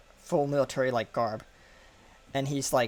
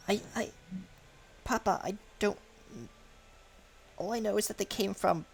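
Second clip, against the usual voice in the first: put-on scared girl to papa; 7 semitones higher, 5 dB quieter than usual. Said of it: "she says".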